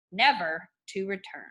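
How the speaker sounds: normal talking voice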